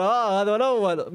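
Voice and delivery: ordinary talk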